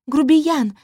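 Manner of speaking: loud, high indignant huff; huffy snort. A narrator's reading. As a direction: snap it out in offense. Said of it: "фыркнула в ответ Витриция".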